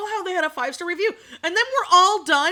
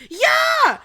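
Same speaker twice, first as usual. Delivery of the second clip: 1 shouted yeah; 10 semitones higher, 7 dB louder than usual. Real, not acted. Shouted agreement to chat.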